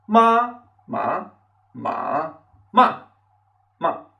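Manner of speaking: "ma" level, rising, dipping, falling, then neutral; each "ma" separate, with its own pitch contour; five different tones. Shown as a demonstration. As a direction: run "ma" through all five Mandarin tones in order. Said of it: "cinco diferentes pronunciaciones".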